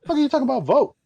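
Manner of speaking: high, disbelieving question; can't believe there's a vote; mock disbelief; incredulous. Incredulous self-quote.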